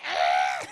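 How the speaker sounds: loud comic shriek; mock scream. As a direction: cry out as if grabbed. Gwen screaming as she is grabbed.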